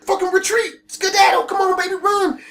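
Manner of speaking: high, urgent mock alarm; imitated panicky hustle-up call; urging retreat. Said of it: "his moronic friend are like".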